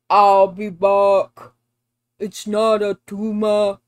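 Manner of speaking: loud mock action-star accent; Arnold Schwarzenegger. Mocking the actor's way of talking, Arnold Schwarzenegger impression. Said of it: "Just like Arnold Schwarzenegger. I can't stand the way he talks".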